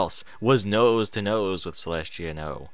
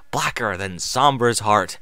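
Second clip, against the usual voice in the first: plain reading voice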